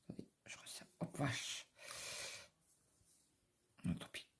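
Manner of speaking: hushed aside, nearly breathless; whispered aside. Real, not acted. Vlogger mutters to herself while tightening the lid, whispered. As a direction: whisper it to yourself while busy.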